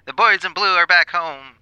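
proud 1940s newsreel delivery; old newsreel narrator. Acted. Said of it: "nineteen forties radio voice".

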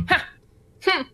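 short scoffing "ha", then a hum. Shown as a demonstration. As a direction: try out a dismissive scoff. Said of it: "Scoff sound like?".

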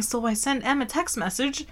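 usual speaking voice

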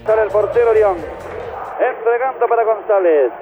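breakneck goal commentary. Real, not acted. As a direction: call it fast, then explode on gol. Radio goal commentary clip.

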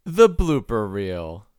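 low, slow show-announcer delivery; dramatic low-pitched reveal; mock announcer. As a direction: announce it with drawn-out ceremony. Announces the blooper reel.

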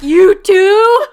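very loud, high-pitched shocked cry; hammed-up incredulous yell; mock outraged disbelief. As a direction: shout it as if utterly betrayed. Man's shocked reaction, retold.

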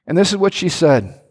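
ordinary talk, nothing marked

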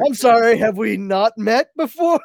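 loud, high mock indignation; mock offended. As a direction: play affronted, on the edge of laughing. Mock offense at being teased.

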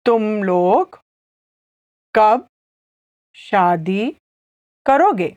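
तुम लोग, कब, शादी, करोगे said slowly with pauses; slow, word by word. Shown as a demonstration. Teacher repeating the question slowly for learners. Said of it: "I'll say it again slowly".

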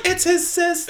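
high mock outcry; retold exclamation. Listener correcting the show from home, high mock exclamation. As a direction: squeal it as an exaggerated reaction.

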